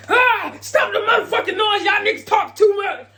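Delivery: high, loud furious yell with a laugh; crazy yelling. Retold or imitated. Big Teak yelling at the noisy hearse. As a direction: yell at everyone to shut up. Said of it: "He goes crazy".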